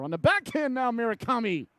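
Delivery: quick excited jump in the call. Real, not acted. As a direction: hit it hard as the shot comes. Save on backhand chance.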